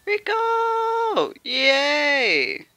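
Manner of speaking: very high joyful cry of the name; delighted squeal. Real, not acted. Gamer squealing with joy at getting Rico.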